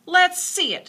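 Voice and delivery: put-on high, loud eager boy; eager shout. A narrator's reading. Boy eager to see the pig, high and loud. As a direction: push in to see.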